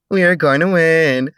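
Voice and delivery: high, loud sing-song teasing; sing-song taunt. A narrator's reading. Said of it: "in a sing-song voice".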